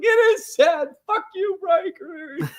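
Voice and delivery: high wailing cry; hammed-up sob at a friend; mock crying. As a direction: blubber it out like a kid. Retold tearful reaction to Titanic.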